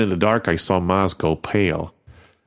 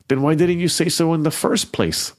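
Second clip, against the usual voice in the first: hollering; 8 semitones higher than usual. A narrator's reading. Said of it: "Maz hollered".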